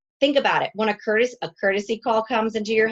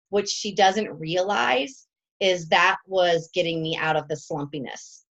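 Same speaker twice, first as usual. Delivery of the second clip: ordinary talk, nothing marked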